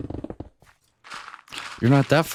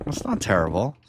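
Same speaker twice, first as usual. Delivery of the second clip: normal talking voice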